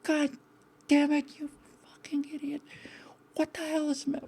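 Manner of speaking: high-pitched, half-whispered curse at himself; retold self-scolding. Remembering cursing himself after hitting the parking block, hushed high mock anger. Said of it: "I remember saying".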